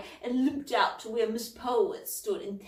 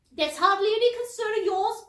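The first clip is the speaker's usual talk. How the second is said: sharp, higher and louder retort; snapped; 11 semitones higher, 8 dB louder than usual. A narrator's reading. Miss Polwarth snapping at Cauldron, sharp. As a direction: bite the words off. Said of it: "snapped Miss Polwarth".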